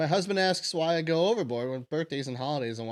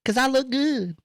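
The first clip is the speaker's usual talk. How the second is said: mocking the woman; 6 semitones higher than usual. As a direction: parrot her circular talk, higher. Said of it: "She's, like, talking in circles".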